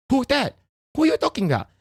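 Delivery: high, incredulous quoted voice; movie character quote. Retold or imitated. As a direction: ask it up high in disbelief. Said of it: "And then he say".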